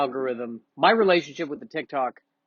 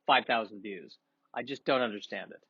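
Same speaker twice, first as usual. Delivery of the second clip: ordinary delivery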